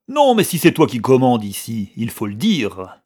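loud angry growl; growled anger. A narrator's reading. Marousse to Chouchou over the bottle, angry growl. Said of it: "il gronda".

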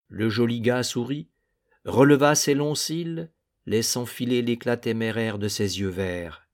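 ordinary delivery, nothing marked

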